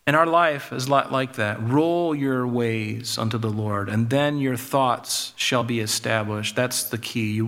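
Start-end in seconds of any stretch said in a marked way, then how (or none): 1.6-6.5 slow, lower, weighing each word; slow emphatic paraphrase. Shown as a demonstration. Preacher restates the proverb for his flock, slow and weighty. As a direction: lay the verse down slowly like a key. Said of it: "literally to roll them out".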